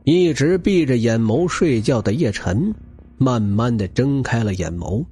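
plain reading voice

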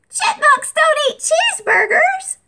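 high squealing chipmunk shout; excited shout. Acted. Animals list foods.

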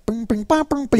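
high playful pinball sounds; pinball noises. Retold or imitated. Showing teachers bouncing around, playful pinball sounds.

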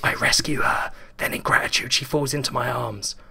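breathy whisper to his partner. A narrator's reading. Detective whispering to Liam about the plot, in a whisper. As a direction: whisper it aside, conspiratorial. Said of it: "I whispered to Liam".

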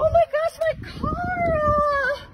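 crying shout. Real, not acted. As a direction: cry out in despair. Owner sees damaged car.

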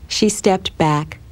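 ordinary delivery, nothing marked

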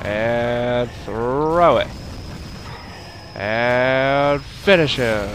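very slow, stretched build-up to the hit; drawn-out move call. Pretend. Gamer timing a throw and finish, drawn-out call. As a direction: stretch the first word until the move lands. Calling own moves in game.

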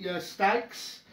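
usual speaking voice

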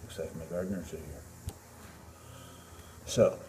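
muffled through full-head mask; talking inside rubber mask. Real, not acted. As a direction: voice behind latex mask. Seen 3: Full rubber horse mask over head throughout labelled words; removed later.

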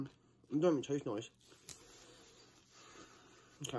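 usual speaking voice

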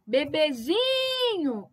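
bebezinho with ZI held long; called out, stressed syllable stretched. Shown as a demonstration. Teacher shows the derived word's tonic syllable, calling bebezinho with a long ZI. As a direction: call out bebezinho slowly, dragging the ZI. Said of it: "como se eu estivesse chamando a pessoa".

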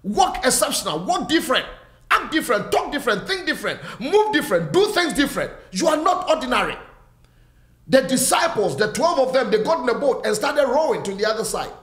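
rising and falling preacher's cadence; fervent preaching. Acted. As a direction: preach it to the whole church with conviction. Preaching resumes after greetings.

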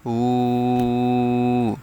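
উ held long and even; long drawn vowel. Shown as a demonstration. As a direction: stretch উ for the inverted pesh. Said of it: "ওয়াও উল্টা পেশ". (উ লম্বা টেনে)

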